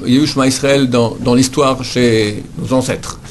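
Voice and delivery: usual speaking voice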